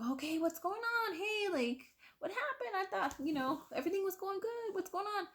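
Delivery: very high, coaxing imitation; doctor's concerned questioning. Doctor gently questioning the patient.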